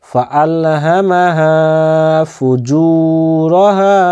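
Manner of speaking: slow melodic tajweed recitation; Quran recitation. Shown as a demonstration. Teacher reciting the verse to students, slow and melodic. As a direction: chant the verse slowly, stretching the long vowels. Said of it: "teacher recites verse for students".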